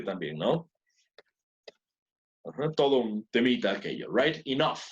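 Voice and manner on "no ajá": normal talking voice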